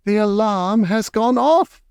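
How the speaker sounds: a lightly voiced witch shriek. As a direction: shriek it in triumph. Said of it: "shrieked the Grand High Witch".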